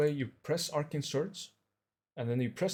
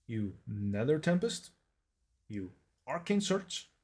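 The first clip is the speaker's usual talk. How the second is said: normal talking voice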